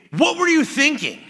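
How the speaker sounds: high, loud exasperated father; imitated harsh dad demanding an answer; upset dad. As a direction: snap at the impulsive son. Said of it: "he would get really upset".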